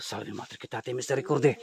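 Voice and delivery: low, very soft prayer; hushed incantation. Real, not acted. Ghost hunter praying at a hidden creature, hushed incantation. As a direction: recite it low under your breath. Recites incantation under his breath.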